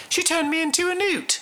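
high-pitched Monty Python peasant cry; film scene quote. Acted. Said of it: "Remember that scene where he's like".

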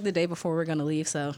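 usual speaking voice

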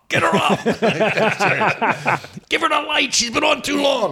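high, strained yell from the back; mock heckling yell. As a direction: call out like an impatient heckler. Acts out heckling wife off stage.

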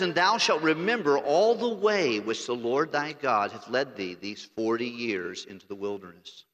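slow, solemn King James reading; scripture reading. Acted. Preacher reading the Bible verse to church, slow and solemn. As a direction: lay each old word down slowly.

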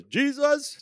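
loud, high call of the name, as if he were in the room; calling out to Jesus. Retold or imitated. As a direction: call out to him like he's standing right here. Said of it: "just like he's right here".

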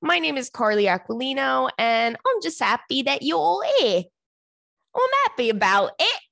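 mock British host greeting; lightly put-on British welcome; playful fake British intro; British accent. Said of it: "I won't do the British".

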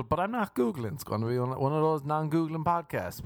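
ordinary delivery

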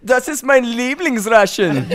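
put-on high, slow camp German accent. Showing off for teasing friends, camp German accent.

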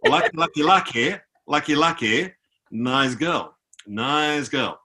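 imitating a creepy stranger's repetitive chant; creepy man. Creepy bald man talks about pop star, imitated. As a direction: mimic an accented leering admirer. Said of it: "dude who was a bit creepier".

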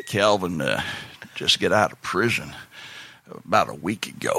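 Uncle Smoke impression. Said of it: "Pretty good Uncle Smoke impression".

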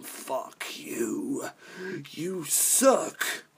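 slow, hammed-up hateful whisper; behind-your-back whisper. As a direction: whisper mock venom, drawing the words out. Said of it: "behind your back, there's like".

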